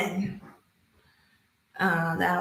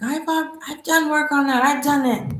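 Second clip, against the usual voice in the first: higher-pitched retold objection; mock protest; 6 semitones higher, 4 dB louder than usual. Speaker retelling their reply to a coach's guidance, mock protesting. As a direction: imitate yourself protesting, pitched up. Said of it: "I was like".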